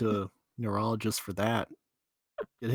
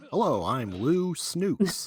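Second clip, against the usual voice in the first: slow, higher mock anchorman intro; newscaster voice; 5 semitones higher, 4 dB louder, much slower than usual. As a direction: announce your name like a TV anchor. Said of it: "Sounds like a newscaster".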